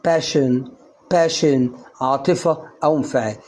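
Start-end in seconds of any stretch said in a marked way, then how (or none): 0.0-1.7 باشن said pashen with sh; sh in passion. Shown as a demonstration. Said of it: "فتنطق شين".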